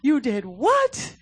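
mock parental outrage, stretched slowly with a leap up; overemotional parent. Parent reacting to child's news at home, overemotional. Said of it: "we're way too emotional sometimes".

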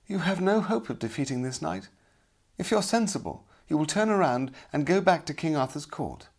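high-pitched, dismissive lady's voice; haughty damsel. A narrator's reading. Damsel discourages her champion before battle, high and dismissive. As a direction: warn him off in a high voice.

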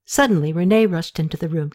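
ordinary delivery, nothing marked

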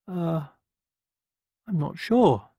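high, hesitant hen; unsure and jittery; nervous hen. A narrator's reading. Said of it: "feeling distinctly nervous".

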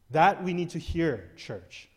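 usual speaking voice

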